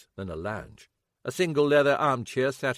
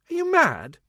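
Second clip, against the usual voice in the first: scowling disbelief; 9 dB louder than usual. Acted.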